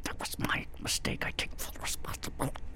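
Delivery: forced whispered apology through clenched teeth. Shown as a demonstration. Grudging apology to his family, whispered through clenched teeth. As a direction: squeeze it out through clenched teeth, barely audible. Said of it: "I say that through clenched teeth".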